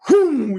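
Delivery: loud magical whoosh; whoom sound. Acted. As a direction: whoosh like a sudden spell.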